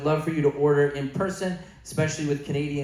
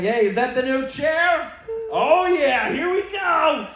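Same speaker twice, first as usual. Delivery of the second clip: high, excited goofball voice, much higher; silly roommate voice; 10 semitones higher, 4 dB louder, slower than usual. Pretend. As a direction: play a cheerful oddball hopping into a chair. Imagined Louis as a weird roommate.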